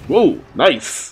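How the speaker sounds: normal talking voice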